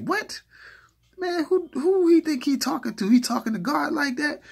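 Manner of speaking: very high, loud, squealing disbelief; outraged disbelief. Retold or imitated. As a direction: lose it in shock at what he wrote. Retold outrage at the insult.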